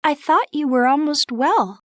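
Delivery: put-on high, gentle young girl's voice; Beth's voice. Narrator voices Beth.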